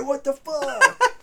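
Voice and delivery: high, slow, loud mock outrage with a laugh; outraged producer. Player voices the producer mourning his broken bong, mock shriek. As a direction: wail it like your prize was smashed. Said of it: "Charles Band's up in the front office".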